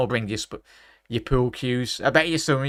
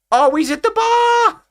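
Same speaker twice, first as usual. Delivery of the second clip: much higher and louder, drawn out slowly; stunned cry; 9 dB louder, slower than usual. Real, not acted. Fan watching Vardy hit the bar, slow high anguished cry. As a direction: wail it as the ball hits the bar.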